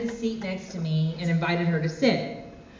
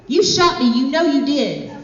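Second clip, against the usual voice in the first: loud, high-pitched hammed-up accusation; upset accusation; 6 semitones higher, 5 dB louder than usual. Teresa pointing at her eye, upset and accusing Philip. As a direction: accuse him in a raised, upset voice. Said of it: "who was now sobbing".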